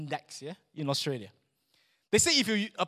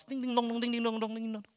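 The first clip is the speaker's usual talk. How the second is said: singsong ding-dong, pitched up on an even tone; clinking coin noise; 4 semitones higher than usual. Retold or imitated. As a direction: imitate coins ringing into a box. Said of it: "makes a lot of noise".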